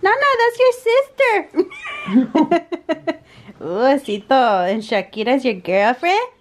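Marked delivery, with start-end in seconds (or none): 0.0-3.2 high, loud laughing words. Real, not acted. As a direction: laugh while talking to pet. Laughing at pets.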